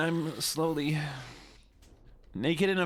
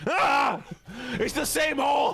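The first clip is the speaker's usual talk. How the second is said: loud, high exasperated cry; frustrated shout; 6 semitones higher, 3 dB louder than usual. Real, not acted. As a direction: yell it in disbelief.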